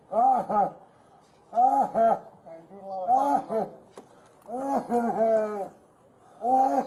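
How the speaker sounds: loud repeated pained groans; each groan forced out by real pain; cries of pain. Real, not acted. Man held down groans loudly in real pain.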